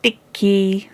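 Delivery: put-on high, sing-song little boy; lightly voiced toddler splashing about; happy toddler. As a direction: chirp it like a playful baby. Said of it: "said he".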